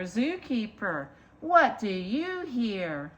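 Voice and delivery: ordinary delivery, nothing marked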